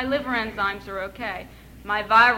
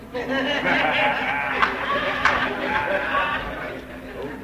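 nervous laugh; 7 semitones lower than usual. Real, not acted. Nervous laugh remembering the syringe.

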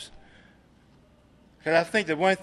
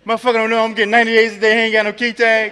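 loud, fast, laughing indignation; mock indignant rant; 4 semitones higher, 8 dB louder, faster than usual. [name] rants to the room about the GSR in mock outrage. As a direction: rattle off the grievance in a comic huff. Ranting about missing key tags.

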